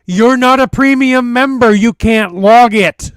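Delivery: loud, high yell down from the tree; yelling back. Acted. Climber up a tree to his brother below, yelling back. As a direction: shout it back, stretching the last words. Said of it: "I yell back".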